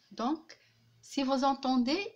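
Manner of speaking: ordinary talk, nothing marked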